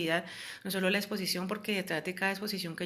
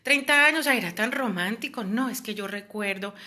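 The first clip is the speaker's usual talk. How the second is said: high, loud mock sigh for the past, fading back; nostalgic victim; 5 semitones higher, 6 dB louder than usual. Victim recalling her once romantic abuser, mock longing.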